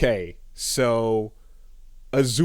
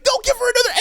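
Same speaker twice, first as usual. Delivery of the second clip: much higher, squealing mock Azula; shrieky protest; much faster than usual. Azula protesting to the crew, squealing much higher. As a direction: squeal it like a bratty kid. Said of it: "And she's like".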